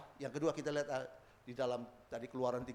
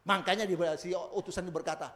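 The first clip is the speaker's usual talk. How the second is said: normal talking voice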